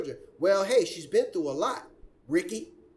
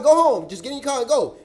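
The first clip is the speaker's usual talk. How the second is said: raised, louder retold order; emphatic higher-pitched urging; urging officer; 4 semitones higher, 7 dB louder than usual. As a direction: imitate an officer sending someone off. Retold police telling drivers to leave.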